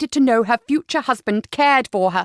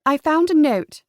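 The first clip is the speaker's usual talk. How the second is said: higher, tense announcement; nervous daughter; 6 semitones higher, 4 dB louder than usual. Acted. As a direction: blurt out the news. Cleo announcing the note.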